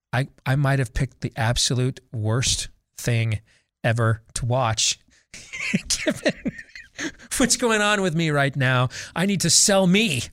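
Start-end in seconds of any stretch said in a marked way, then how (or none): 5.3-6.0 laugh. Real, not acted. Laughs at own bad choice.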